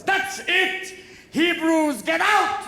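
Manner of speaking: high, loud order to leave; fed-up shout. Acted. Pharaoh expelling the Hebrews, fed-up shout. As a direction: explode and throw them all out.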